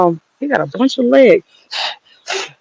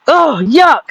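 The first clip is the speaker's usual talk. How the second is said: a grossed-out ugh, pitched up; disgusted; 5 semitones higher than usual. Real, not acted. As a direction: recoil at the bugs. Bugs pour out of compost bin.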